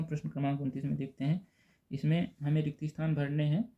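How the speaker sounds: ordinary delivery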